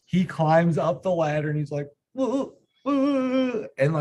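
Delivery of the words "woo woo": high-pitched mock sobs; whimpery fake kid cry. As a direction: imitate a hurt little kid wailing "woo-hoo". Acting out injured son crying.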